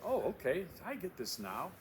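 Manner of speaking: high, sudden realization; mock aha. Student voiced realizing their topic was too big. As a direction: act out the student's lightbulb moment. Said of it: "there's gonna be an aha moment".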